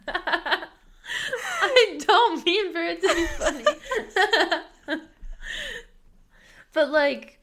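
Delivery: high voice, near tears with laughter; laughing. Real, not acted. Speaker tells cohost it wasn't a joke, laughing. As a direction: protest through helpless giggles. Protesting amid shared laughing fit.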